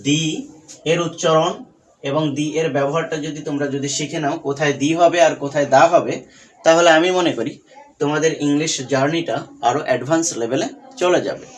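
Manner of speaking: usual speaking voice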